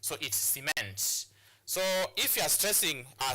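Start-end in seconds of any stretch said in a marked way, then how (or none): 0.4-1.2 "cement" slowly as si-MENT; verb-style si-MENT, weight on the end; stress on second syllable. Shown as a demonstration. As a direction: hold back, then land on MENT in "cement". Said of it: "you stress on the second syllable here".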